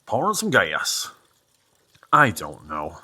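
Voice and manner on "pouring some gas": put-on southern accent. Trying how they say it down south, terrible southern accent. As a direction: try out a bad down-south twang. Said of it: "how they say it down south".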